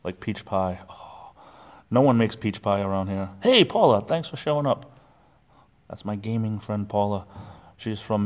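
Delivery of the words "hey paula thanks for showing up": ordinary delivery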